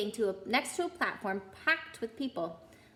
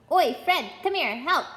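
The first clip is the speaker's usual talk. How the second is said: loud, high call across platform; 5 semitones higher, 7 dB louder than usual. A narrator's reading. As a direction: shout for your brother. Twin calling brother over.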